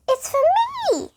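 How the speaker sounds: a mild high squeak; light mouse squeak. Acted. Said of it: "he squeaked".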